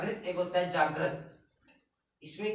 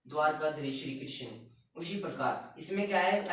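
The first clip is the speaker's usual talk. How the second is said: ordinary talk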